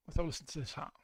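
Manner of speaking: ordinary delivery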